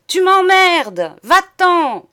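slow, high, very loud dismissal; coarse washhouse yell. A narrator's reading. Madame throwing monsieur out, coarse furious shout. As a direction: bellow each word at him. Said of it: "vomit madame d'une voix de lavoir".

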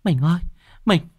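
very high, stammering, frightened voice; scared wife gasping out her story, pitch way up; panting, terrified wife. Acted. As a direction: stumble over the words in high-pitched panic. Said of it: "mặt cắt không còn giọt máu hổn hển".

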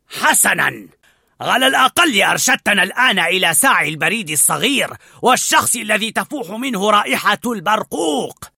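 a leader mocking his failed dog; loud, contemptuous; scornful pack leader. A narrator's reading. Narrator voices Alpha.

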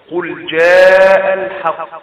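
slow loud chant, الحق stopped on a bounced doubled ق; strongest qalqalah. Shown as a demonstration. Teacher stopping on الحق, loud slow recitation with strong qalqalah. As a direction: hold جاء, then hit and rebound the ق of الحق. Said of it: "فكلمة الحق وقفنا عندها".

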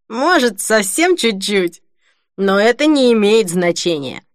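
tipsy, slurred, loud; drunk hiccuping. A narrator's reading. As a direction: drunk man on the phone. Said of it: "икнул он в трубку".

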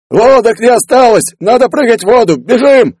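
loud, very high frantic yells; panicked shouting. Acted. Crew flees the sinking submarine, panicked shouting. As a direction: yell frantically to abandon ship.

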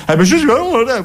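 high-pitched grammelot gibberish; mock grammelot outburst with noises; Dario Fo. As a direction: order coffee in theatrical nonsense, ending in a hiss. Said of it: "va a ordinarsi un caffè al bar in Gramblò".

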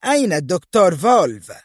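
high-pitched angry demand; angry call. A narrator's reading. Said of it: "ثم صاحت في غضب". (صياح غاضب بنبرة مرتفعة)

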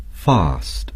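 British English fast, with a long open ah; fast said the British way like fahst; British pronunciation of fast. Shown as a demonstration. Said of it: "The British would say".

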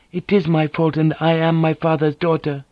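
a dignified woman, hurt and firm; proud wounded noblewoman. Acted.

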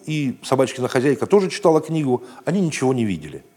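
plain reading voice

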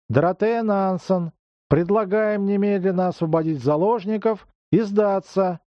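high booming megaphone address; loudspeaker voice. Acted. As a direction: demand surrender over a PA. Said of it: "голос из громкоговорителя".

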